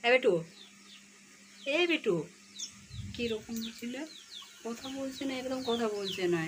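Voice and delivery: high, bright calling of a pet bird; high coaxing pet call. Real, not acted. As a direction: call the bird sweetly, then complain.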